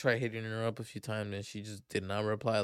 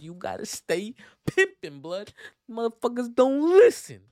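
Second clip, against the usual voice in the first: rising rant; 11 semitones higher, 10 dB louder than usual. Real, not acted. Ranting advice to viewers.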